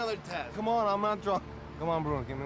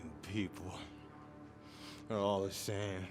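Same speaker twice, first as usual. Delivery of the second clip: bitter contempt; 10 semitones lower, 9 dB quieter than usual. Acted. Drunk man to churchgoers he resents, low contempt. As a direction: sink low with weary scorn.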